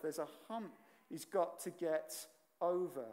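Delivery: ordinary talk, nothing marked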